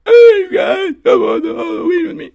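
very high, loud, squeaky wail; jacked, cracking voice. Pretend. Host invites listeners to Halloween, voice cracking. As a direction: push the cracked voice into a strained squeal. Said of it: "My voice is jacked".

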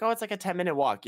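high mock casual voice; imitating receptionist. Airport clerk brushing off the long walk, mock high. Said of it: "He's like".

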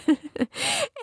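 high burst of laughter. Real, not acted. Preacher laughing at her childhood prank, high burst of laughter.

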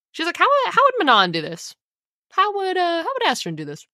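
lightly acted, high-pitched wondering; imitated self-questioning. Voices heroine asking herself.

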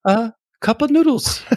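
very high, silly mock British; fake British accent. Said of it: "I don't think that's British".

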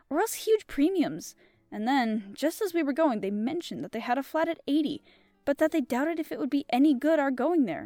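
measured audiobook reading with character voices; storybook reading voice; story narration. A narrator's reading. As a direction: tell the tale steadily to listeners. Reads the story aloud.

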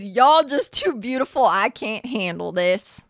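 mimicking Hall's Southern accent. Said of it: "that was a good accent".

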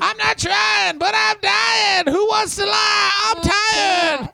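high, slow wailing promo yell; wrestling promo shout. Retold or imitated.

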